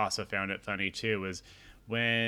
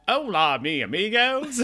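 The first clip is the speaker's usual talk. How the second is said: higher and louder, hammed-up bad Spanish accent; 10 semitones higher, 7 dB louder than usual. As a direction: greet them like a cartoon Spanish-accented announcer, on purpose badly. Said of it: "really bad Spanish accent on purpose".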